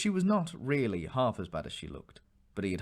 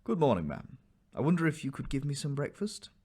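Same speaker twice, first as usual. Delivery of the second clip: polite; 5 semitones higher than usual. A narrator's reading. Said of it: "he said quite politely".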